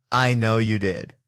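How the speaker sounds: loud, slow, deliberate; firm accusation. Acted. Detective answering a yelled confession, firm and loud. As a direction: land each word on the suspect.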